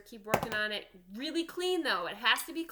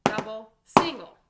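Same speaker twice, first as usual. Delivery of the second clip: loud drum call with the accent on the last word; loud called sticking; 11 dB louder than usual. Shown as a demonstration. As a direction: shout each stroke, with the last word hit hardest. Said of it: "Make sure to get the accent".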